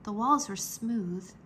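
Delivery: plain reading voice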